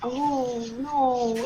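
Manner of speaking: ordinary talk, nothing marked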